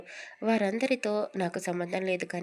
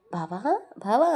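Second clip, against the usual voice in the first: louder wake-up call, bava bava; 4 dB louder than usual. Acted. Charitha wakes Karthik by calling him, louder. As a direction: call him awake from across the room.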